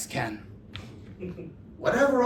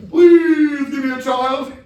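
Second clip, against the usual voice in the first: louder, slower begging shout; loud plea; 4 semitones higher, 11 dB louder than usual. Acted. Begging the stars for a child, loud and slow. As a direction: cry it out to the heavens. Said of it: "repeat louder plea".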